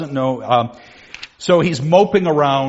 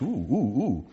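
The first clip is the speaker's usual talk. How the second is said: ordinary talk, nothing marked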